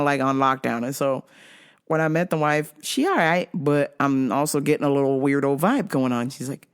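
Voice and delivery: usual speaking voice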